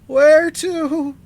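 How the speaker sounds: ordinary delivery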